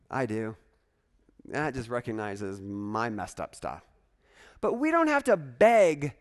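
ordinary talk, nothing marked

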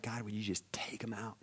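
soft, unvoiced whisper; whispered imagined prayer; whispered plea. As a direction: breathe it like a secret prayer. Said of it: "Have you ever been like".

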